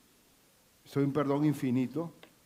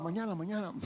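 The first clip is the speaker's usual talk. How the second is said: stalling debtor; 3 semitones higher, 7 dB quieter, faster than usual. Acted.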